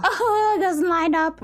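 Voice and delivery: high, loud frustrated wail; high-pitched exasperated whine. Real, not acted. Frustrated by game pieces not aligning.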